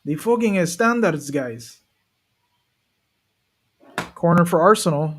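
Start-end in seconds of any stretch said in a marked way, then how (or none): 0.0-1.8 slow, bad Spanish-accented football manager, pitched up then dropping; mock Spanish-accented coach's catchphrase; terrible Mikel Arteta. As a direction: drag it out in a clumsy Spanish accent. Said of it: "That's a terrible Mikel Arteta".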